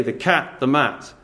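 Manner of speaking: "The cat, the mat" as two bare chopped fragments; verbless fragment. Shown as a demonstration. Preacher showing a sentence without its verb, chopped fragments. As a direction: drop the verb and leave "The cat," hanging. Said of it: "So if you just say".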